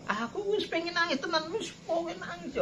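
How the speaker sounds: high, soft mock-tearful whine; fake crying. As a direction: whimper it as if trying to cry. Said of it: "Ora iso nangis pura-pura".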